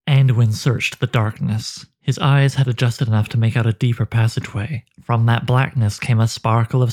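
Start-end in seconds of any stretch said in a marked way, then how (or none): none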